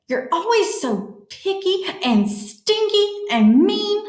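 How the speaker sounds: high, whiny storybook insult; childish name-calling. Acted. Matthew calls his friend names in a grouchy quarrel voice.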